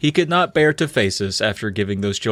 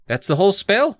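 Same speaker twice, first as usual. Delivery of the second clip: raised, lightly acted question; questioning boy; 7 semitones higher than usual. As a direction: ask it as a mildly puzzled character. First try at Edward's question.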